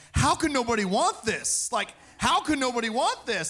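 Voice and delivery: bursting with joy, can't understand them; excited pleading. Retold or imitated. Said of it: "running around to strangers going".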